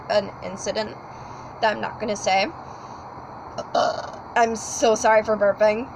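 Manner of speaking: usual speaking voice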